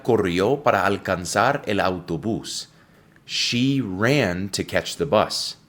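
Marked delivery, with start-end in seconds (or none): 3.3-5.6 each word spelled out slowly for learners; slow model sentence. Shown as a demonstration. Teacher reading the sentence slowly to students, very slow. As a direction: read it slowly so students catch every sound. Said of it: "escucha la pronunciación muy atentamente".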